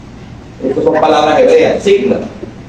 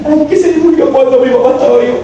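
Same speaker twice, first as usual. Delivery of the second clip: mock lament; 7 semitones higher than usual. Mocking a lamenter.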